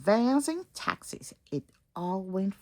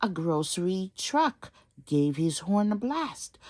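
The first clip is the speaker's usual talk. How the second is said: ordinary delivery, nothing marked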